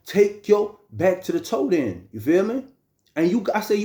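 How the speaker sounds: usual speaking voice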